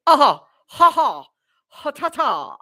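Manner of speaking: a high, loud, hammed-up British-accent laugh and Ahoy; mock British laugh. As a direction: playfully imitate the girl's laugh in a British accent. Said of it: "that didn't even sound right".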